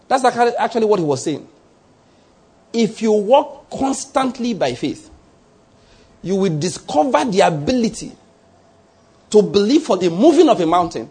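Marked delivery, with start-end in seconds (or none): 2.7-5.1 slow, higher, each word weighed; slow emphasis. Shown as a demonstration. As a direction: press the point slowly. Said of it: "I just want to emphasize that".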